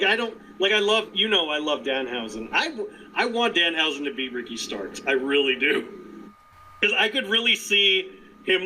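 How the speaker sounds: normal talking voice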